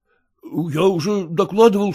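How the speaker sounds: plain reading voice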